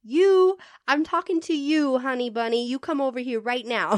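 celebrity calling out to a fan. Retold or imitated. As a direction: call her over boldly. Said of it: "[name]'s like".

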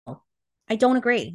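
usual speaking voice

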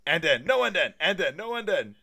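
high, loud mock argument; volley the words like a stubborn squabble; acted heated back-and-forth; back-and-forth bickering. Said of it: "back and forth".

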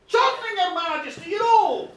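loud, high explanation; acted insistent reply; emphatic confirmation. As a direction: spell it out loudly to the king. Woman confirms to puzzled king.

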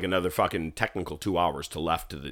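ordinary talk, nothing marked

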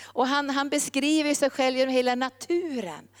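usual speaking voice

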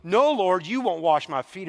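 loud, emphatic protest; proud refusal. Retold or imitated. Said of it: "pride a little bit in there".

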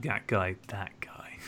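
whispered, fading almost to nothing; muttered aside. Pretend. Host recalling his rival from the other podcast, whispered mutter. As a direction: mutter it under your breath, trailing away.

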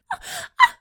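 very high squeaky squeal. Shown as a demonstration. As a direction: let out a startled squeal. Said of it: "Give me a squeal".

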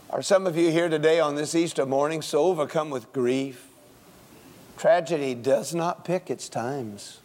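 ordinary talk

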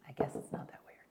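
whispered aside. Real, not acted. Host whispering to her audience, whispered. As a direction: breathe it as a secret.

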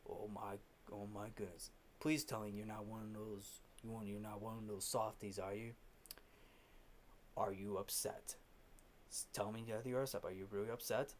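low, soft mock disbelief; quiet taunting. Fletcher taunts crying Neiman, quiet low mockery. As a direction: taunt softly, pitch dropped low.

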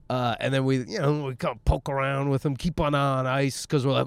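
normal talking voice

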